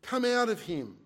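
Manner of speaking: loud, high commanding order; stern. A narrator's reading. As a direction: cast it out with stern force. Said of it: "said Jesus sternly".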